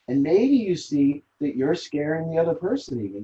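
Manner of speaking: normal talking voice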